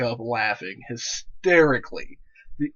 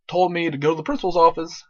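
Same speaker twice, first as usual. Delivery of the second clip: ordinary talk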